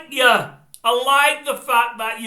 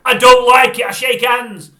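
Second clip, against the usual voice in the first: louder, emphatic I don't like it; loud protest; 3 semitones higher, 13 dB louder than usual. Real, not acted. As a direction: bang the words out in protest. Ranting about fist pumps.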